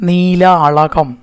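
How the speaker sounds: നീലാളകം said very slowly with a clear retroflex ള; careful correct reading. Shown as a demonstration. Teacher models the right reading നീലാളകം, slow retroflex ള. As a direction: spell out നീലാളകം syllable by syllable, curling the ള. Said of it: "അങ്ങനെ വായിക്കരുത്".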